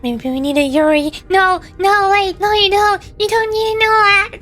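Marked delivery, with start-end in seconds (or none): none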